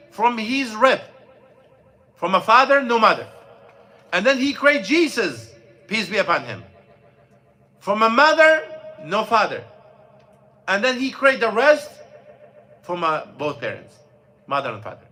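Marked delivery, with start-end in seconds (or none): none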